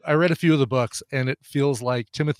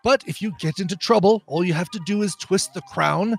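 raised, fussy, exasperated quartermaster; John Cleese as Q; 4 semitones higher than usual. Acted. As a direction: primly lecture the careless agent. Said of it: "I'm doing the John Cleese".

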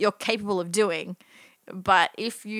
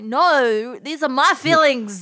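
mock indignant; 4 semitones higher, 6 dB louder, much slower than usual. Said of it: "and I'm like".

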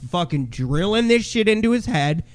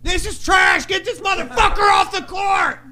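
very high, strained sideline scream; acting out an enraged heckler; retold hollering; 13 semitones higher than usual. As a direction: holler it across the gym. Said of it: "I was fucking hollering from the sidelines".

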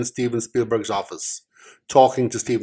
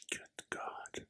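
whisper; 24 dB quieter than usual. Real, not acted. Muttered aside after speaking up.

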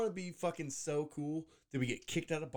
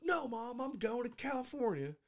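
higher-pitched imitation of a grounded child; defiant kid; 9 semitones higher than usual. Grounded kid talking back to Mom, hammed-up defiance. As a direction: protest like a mock-petulant kid.

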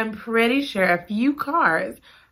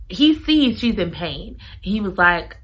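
ordinary delivery

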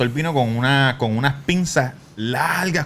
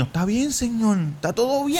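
reassuring patient; 9 semitones higher than usual. Retold or imitated. Answering other patients.

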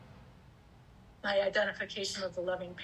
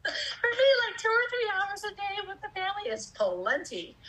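teary; 10 semitones higher than usual. Real, not acted. Voice breaks while explaining needs.

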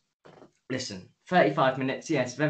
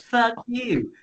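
much higher, louder hammed-up "Fuck you"; mock furious yell; 11 semitones higher, 6 dB louder than usual. Reviewer acting out a character cursing someone, hammed-up shout. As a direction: fling it back like a cartoon tantrum. Retold character snaps back.

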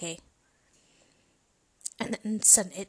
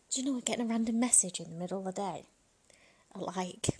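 ordinary delivery

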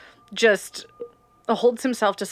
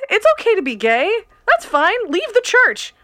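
exasperated plea; 11 semitones higher, 4 dB louder than usual. Real, not acted. Pleading to Tom Cruise.

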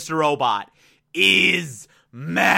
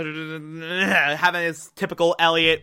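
ordinary talk, nothing marked

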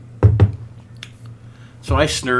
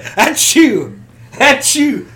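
fake sneezes; 9 semitones higher, 7 dB louder, slower than usual. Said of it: "I'd be like".

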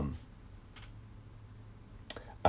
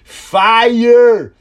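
high, drawn-out imitated yell; loud put-on shout, far above normal pitch; 13 semitones higher, 12 dB louder than usual. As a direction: holler it like an excited moviegoer. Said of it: "the guy to just yell out".

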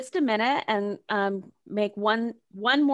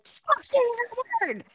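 high, slow mock scolding of a child; a parent snapping at a swearing toddler, slow and high; harsh scolding parent; 14 semitones higher, slower than usual. Parent scolding a toddler for swearing, high and slow. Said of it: "not helpful to be like".

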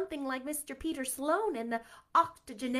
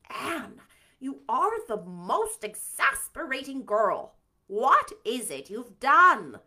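louder, slow exasperated scold; 9 dB louder, slower than usual. Acted. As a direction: clip it in weary exasperation. Marilla exasperated with Anne.

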